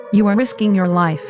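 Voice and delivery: usual speaking voice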